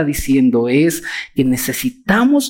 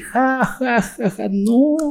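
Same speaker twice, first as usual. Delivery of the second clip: a higher, hammed-up scornful ja ja; mock mocking laugh; 8 semitones higher, much slower than usual. Preacher mimics the world laughing at him: mock scornful laugh. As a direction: laugh it off like the scoffing world, in fun. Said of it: "Y el mundo se ríe".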